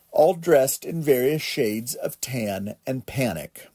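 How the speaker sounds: ordinary delivery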